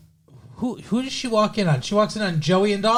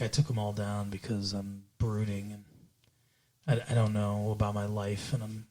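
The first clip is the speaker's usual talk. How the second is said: low, much softer mock brooding; brooding teenager; 8 semitones lower, 7 dB quieter than usual. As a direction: mumble it like a moody, self-absorbed teen.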